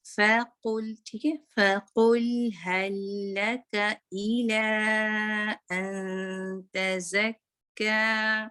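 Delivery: slow chanted recitation; drawn-out Quran recitation. Shown as a demonstration. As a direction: round the damma, let air out on fa. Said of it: "काफ की दम्मा को गोल करें".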